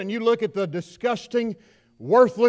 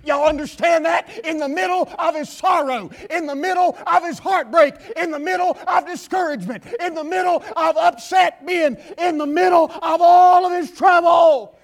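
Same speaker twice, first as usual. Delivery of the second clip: higher, louder building cry; building preaching; 6 semitones higher, 5 dB louder than usual. Acted. Preacher building up over Jeremiah's sorrow, rising to a shout. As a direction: stack each phrase higher to a shout.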